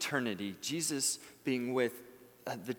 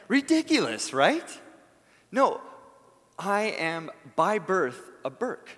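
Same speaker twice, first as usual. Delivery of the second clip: emphatic exclamation; 5 semitones higher, 7 dB louder than usual. Real, not acted. Rhetorical rebuttal to imagined doubt.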